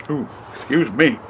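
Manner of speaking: talking through a burp; holding back a burp while speaking; burping the words. Real, not acted. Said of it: "Burping, trying not to burp".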